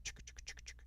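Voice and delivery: quick whispered train chuffs; imitated chugging train. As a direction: chuff softly like a passing train. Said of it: "when trains go by, like".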